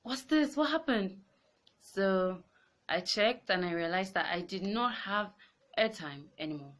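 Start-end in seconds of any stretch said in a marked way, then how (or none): 0.0-1.2 surprised. Retold or imitated. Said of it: "And I'm like".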